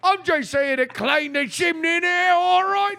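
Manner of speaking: hammed-up Cockney, high and shouted; mock Cockney. Mocking the guest's oi as a Cockney chimney sweep. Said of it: "You said it like a Cockney".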